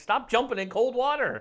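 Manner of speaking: high, loud mock scolding; playful exasperated outburst; exasperated plea. Acted scolding of someone's cold plunges.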